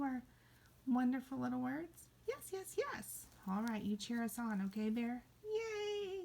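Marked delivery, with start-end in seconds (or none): 2.3-3.3 very high, tiny eager voice; puppet bear voice. Pretend. Bear agrees to sit, in puppet voice. 5.4-6.2 very high little cheer; cheering puppet bear. Pretend. Bear cheers on the class, cheering. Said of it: "He's cheering".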